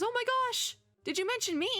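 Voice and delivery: high-pitched, flustered gushing; acted breathless panic over a crush; flustered excitement. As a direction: squeal it like a starstruck fan. Mo panics about being mentioned.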